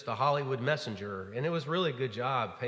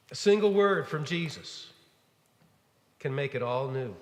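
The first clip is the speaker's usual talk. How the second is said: ordinary talk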